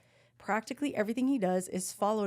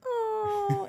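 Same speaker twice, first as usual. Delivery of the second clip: very high gasping oh; a high mocking coo; mock swoon; 13 semitones higher than usual. Classmates watching, a mock high-pitched oh. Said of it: "everyone's looking at us going".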